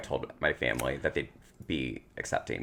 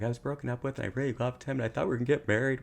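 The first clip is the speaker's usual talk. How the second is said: higher, imitating her own past sobbing plea; retold bawling; 8 semitones higher, faster than usual. Telling mom about the breakup, mock tearful and higher. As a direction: replay the tearful plea, pitched up and rushing. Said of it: "and I'm bawling now".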